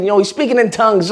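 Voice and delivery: ordinary delivery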